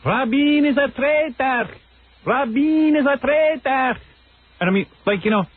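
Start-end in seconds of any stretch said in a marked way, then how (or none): 0.0-4.0 high, slow chanting protester voice; mock a ranting street protester; imitating protesters.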